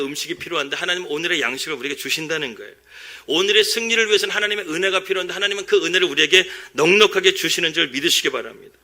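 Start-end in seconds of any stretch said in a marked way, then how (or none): 3.3-8.8 rising, louder preaching climax; preaching at full force. Acted. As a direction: build the sermon to a peak. (힘차게 외치는 설교)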